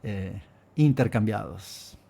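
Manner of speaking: ordinary talk, nothing marked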